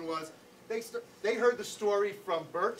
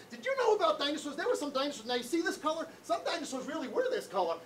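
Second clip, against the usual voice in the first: put-on higher, insistent dinosaur-obsessed kid; in-your-face kid; 6 semitones higher than usual. Odd kid crowding classmates with dinosaur facts, in-your-face and insistent. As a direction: gush about dinosaurs right into someone's face. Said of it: "right in your face".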